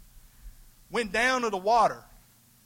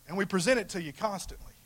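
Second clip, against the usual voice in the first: ordinary delivery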